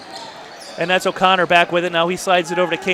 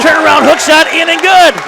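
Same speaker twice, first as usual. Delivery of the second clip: loud, raised-pitch scoring call; excited play call; 8 semitones higher, 6 dB louder than usual. Real, not acted. Commentator calling the hook shot, excited. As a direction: belt out the basket as it falls. Caller on a scored basket.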